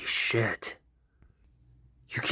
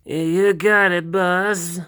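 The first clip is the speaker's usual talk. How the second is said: nervous stammer; 9 semitones higher, 7 dB louder, slower than usual. Acted. Himeno answers her boss nervously.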